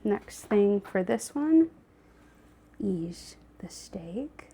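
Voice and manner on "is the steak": slow whispered aside; whispering. Real, not acted. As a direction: breathe it out slowly. Whispering aside while cooking.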